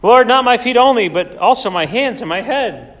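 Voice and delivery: a sudden high, loud burst of eagerness; eager Peter. Acted. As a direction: swing from refusal to overeager pleading. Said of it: "swings to the other end of the spectrum".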